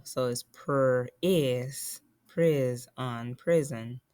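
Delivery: slow, split prison into pris and on; sounding out syllables. Shown as a demonstration. Teacher slowly splits prison into syllables for pupils. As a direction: break the word apart syllable by syllable. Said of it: "the I is not at the end".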